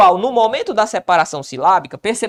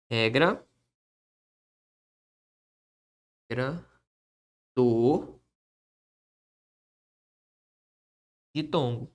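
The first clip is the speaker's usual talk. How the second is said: slow, soft dictation to the board; dictating while writing; 7 semitones lower, 6 dB quieter, slower than usual. Shown as a demonstration. Teacher dictating the rule as he writes it, slow and soft. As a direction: spell it out slowly as you write.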